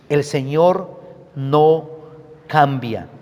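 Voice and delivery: slow, stretched words, each one weighed; slow emphatic statement. Shown as a demonstration. Preacher drawing out a key truth, slow and weighty. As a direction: lay it down slowly for the congregation.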